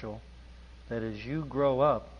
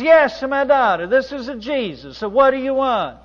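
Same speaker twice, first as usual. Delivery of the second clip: higher and louder booming divine voice; louder mock God voice; 11 semitones higher, 11 dB louder than usual. Painter calls louder to nun, pretending to be Jesus. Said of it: "You need to say a little louder".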